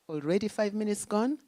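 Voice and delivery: ordinary talk, nothing marked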